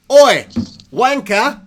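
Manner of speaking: loud, high mock Cockney shout; British accent. Told by the chat to say wanker, loud mock British. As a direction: bark it like a cartoon Brit. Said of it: "Dan Moorcraft wants me to say wanker".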